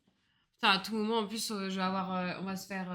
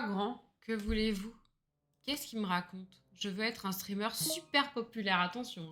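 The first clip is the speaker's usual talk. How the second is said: ordinary talk, nothing marked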